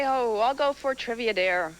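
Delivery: ordinary talk, nothing marked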